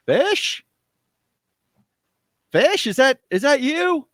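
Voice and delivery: high, loud call across the mist; Hutch calling out. Acted. The wolf beast in Hutch's voice calling to Fish through the mist, high and loud. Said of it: "and he's like".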